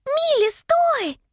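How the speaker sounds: high, drawn-out call to stop; very high, stretched-out yell; shouted. A narrator's reading. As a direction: call high and slow across a distance. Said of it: "закричала Анника".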